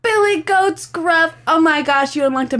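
excited. Real, not acted. Excited at a memory.

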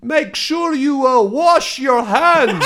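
loud, high, slow Dutchman impression; hammed-up Dutch man yelling a warning; shouting old Dutchman. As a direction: holler down from a balcony as a Dutch neighbour. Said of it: "the Dutchman again".

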